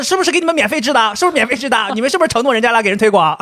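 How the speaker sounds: high, loud imitation of suspicious listeners; mock accusation. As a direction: pester them with hammed-up suspicion.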